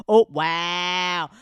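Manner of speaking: usual speaking voice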